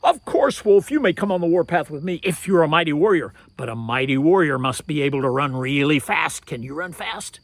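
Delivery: loud, fast flattering talk; flattering challenge. Acted. Turtle challenges the wolf, loud fast coaxing. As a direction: coax him into a race.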